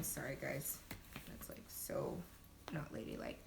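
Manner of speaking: embarrassed whispered aside. Real, not acted. Apologizing to viewers, whispered. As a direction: drop to a whisper, a little sheepish.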